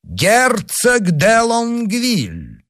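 very high, drawn-out herald's call; loud formal announcement. A narrator's reading. Liveried footman announcing a duke, loud ringing call. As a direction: announce the guest, stretching each word slowly. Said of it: "доложил громким голосом".

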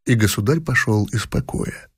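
ordinary delivery, nothing marked

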